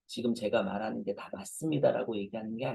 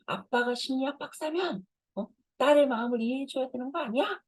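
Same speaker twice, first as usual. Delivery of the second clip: put-on much higher, quick teen daughter talking back; daughter's voice; 14 semitones higher, 3 dB louder than usual. Teen daughter talks back to her psychologist dad, high and quick. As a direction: pipe up like a cheeky teenage girl protesting. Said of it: "제 딸이 저한테 그러더라고요".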